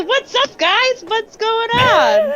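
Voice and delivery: high-pitched, drawn-out hey-guys voice. Acted. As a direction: burst in with a high, showy greeting. Player voices his character barging in.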